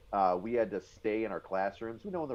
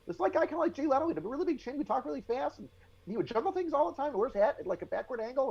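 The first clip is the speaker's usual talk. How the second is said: much higher and fast, a lightly voiced jittery commander; company commander impression; faster than usual. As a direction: rattle it off high and quick like the chinny captain. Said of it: "when I would do our company commander".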